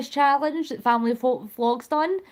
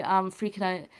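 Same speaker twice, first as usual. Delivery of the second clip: ordinary delivery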